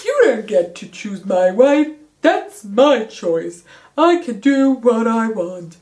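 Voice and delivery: loud, petulant mock outburst, spitting each word; mocking Maxon's tantrum. Recapper mocks Maxon refusing an arranged marriage, loud bratty voice. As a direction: parody a spoiled prince refusing orders. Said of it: "he's all like".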